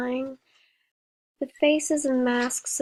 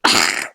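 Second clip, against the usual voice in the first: loud rasping choke; choking cough; 8 dB louder than usual. A narrator's reading. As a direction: choke harshly. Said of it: "An horrible choking sound".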